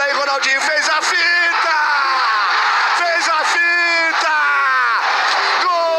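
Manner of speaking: high, slow screamed goal call; explosive goal commentary. Acted. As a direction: drag out golaço in ecstasy.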